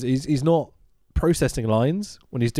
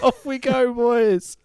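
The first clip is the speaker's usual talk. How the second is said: high, cheery send-off; excited mock cheer; 11 semitones higher, much slower than usual. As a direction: cheer them on like a coach.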